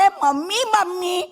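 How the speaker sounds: put-on high, childish questioning voice; little boy asking. Little boy asks his mummy a silly question, high childlike whine. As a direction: pipe up like a curious little boy. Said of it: "બાબાએ મમ્મીને પૂછ્યું".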